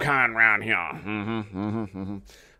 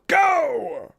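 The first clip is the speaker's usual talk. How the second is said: shouted go; 4 dB louder than usual. Pretend. Starting the sip.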